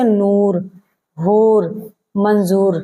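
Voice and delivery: नूर हूर मंजूर with a long full oo; clear long oo. Shown as a demonstration. Teacher demonstrating the long oo sound to students. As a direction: stretch the oo in नूर. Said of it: "पेश के साथ पढ़ा जाए".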